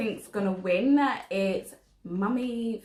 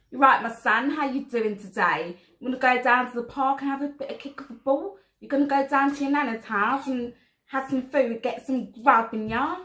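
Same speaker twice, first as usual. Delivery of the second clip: louder, fast, hammed-up Cockney; Cockney accent; 4 semitones higher, 5 dB louder, faster than usual. Shown as a demonstration. Mum showing the child Cockney, chatty hammed-up Cockney accent. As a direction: chat away like a Cockney parent. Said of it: "Cockney accent, and I'll go first".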